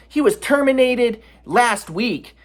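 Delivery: slow, high, cold imitation; mock Becca. Friend Becca delivering the news, slow high mock. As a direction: draw it out coldly.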